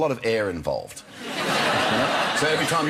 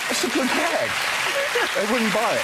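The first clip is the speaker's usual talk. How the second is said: high, louder laughing words; laughing through it; 14 semitones higher, 4 dB louder than usual. Real, not acted. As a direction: keep laughing as you talk. Still laughing after the gag.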